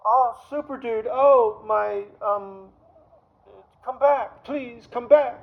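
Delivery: high, loud, hammed-up pleading girl voice; mock pleading woman. Coworker calling him back, high loud mock pleading.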